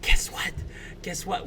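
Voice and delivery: eager secret-telling whisper; excited whisper. Acted. Rosie whispering to her uncle as she hugs him, excited whisper. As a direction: whisper it like you are bursting with news. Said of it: "she's gonna whisper".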